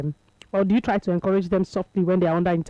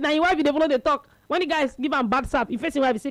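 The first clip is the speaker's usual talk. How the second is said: usual speaking voice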